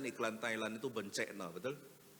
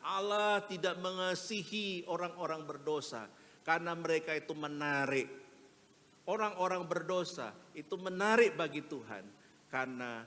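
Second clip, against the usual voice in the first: loud, higher, driving sermon voice; preaching at full force; 5 semitones higher, 6 dB louder than usual. Acted. Preacher quotes Martin Luther to the congregation, loud and driving. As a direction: hammer the point home from the pulpit.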